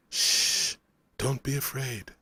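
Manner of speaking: a hint of a deep, musical foreign voice. A narrator's reading. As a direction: soothe a frightened, feverish boy. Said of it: "The voice was deep, the accent was thick and round".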